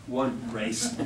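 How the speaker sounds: ordinary talk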